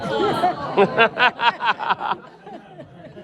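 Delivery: high loud laughing fit; loud burst of laughter. Real, not acted. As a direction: break into big laughter. Laughing at song authorship confusion.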